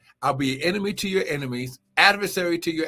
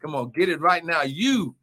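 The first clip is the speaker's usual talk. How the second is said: fiery preaching; 4 semitones higher than usual. Acted. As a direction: shout the praise, build the fire. Preaching at full force.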